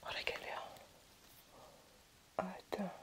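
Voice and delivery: whispered while holding breath; breath held, barely voiced. Real, not acted. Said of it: "Je retiens ma respiration".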